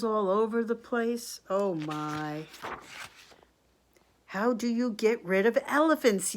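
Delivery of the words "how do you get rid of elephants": raised, louder kingly demand; storybook yell. A narrator's reading. King asking how to lose the elephants, storybook yell. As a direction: call it out as an exasperated king, mildly. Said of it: "yelled the king".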